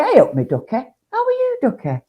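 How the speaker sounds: lilting Nottingham accent, pitch jumping up high; Nottingham greeting. Shown as a demonstration. Nottingham local greeting a friend, lilting accent. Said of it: "So you'd say".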